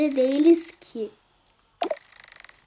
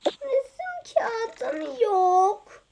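whiny complaint; 6 semitones higher, 4 dB quieter, much slower than usual. Acted. Whining again about paper.

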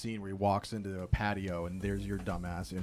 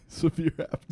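laughing; 7 semitones higher than usual. Real, not acted. Echoing friend's phrase, amused.

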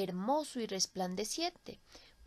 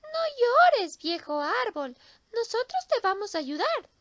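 high, louder reassuring bird voice; comforting little bird; 12 semitones higher, 9 dB louder than usual. Acted. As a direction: console the old tree as a goldfinch. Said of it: "dijo el jilguero".

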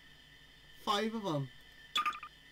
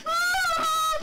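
shriek; 7 dB louder than usual. Real, not acted.

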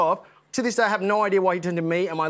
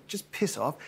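muttered insult; 8 dB quieter, slower than usual. Retold or imitated. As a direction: drop it under your breath. Retelling a muttered parting insult.

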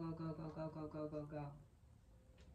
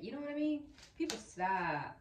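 sudden burst, much louder and higher; loud exclamation; 7 semitones higher, 9 dB louder than usual. Real, not acted. Excited exclamation to viewers.

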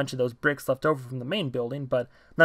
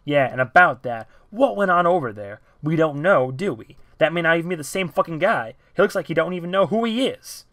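1 heated rebuttal; 4 semitones higher, 6 dB louder than usual. Acted. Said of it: "T J rebutted".

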